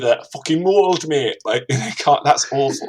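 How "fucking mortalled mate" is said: high-starting attempt at Geordie; badly faked Geordie accent. Shown as a demonstration. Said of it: "like, Geordie".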